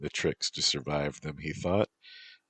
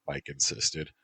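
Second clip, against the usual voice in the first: ordinary delivery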